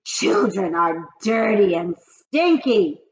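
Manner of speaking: loud slow witch cry; witch scream. Acted. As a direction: spit it out slowly. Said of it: "screamed the Ga-, Grand High Witch".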